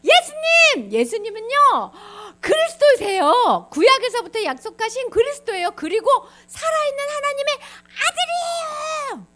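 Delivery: excited, proud proclamation, stretched at the end; eager Peter answering. Acted. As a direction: shout the answer like a keen pupil. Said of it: "베드로가 손을 딱 들더니".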